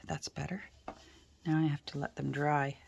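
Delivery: ordinary talk, nothing marked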